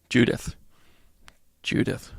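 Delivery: soft whisper of the name. Real, not acted. Host whispering to viewer Judith. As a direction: whisper her name fondly.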